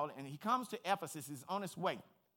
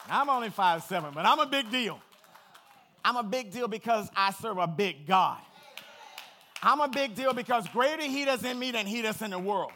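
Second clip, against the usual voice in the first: much louder, higher preaching; full-force preaching; 6 semitones higher, 11 dB louder than usual. Real, not acted. Preacher to congregation, triumphant loud preaching. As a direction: shout it with triumph.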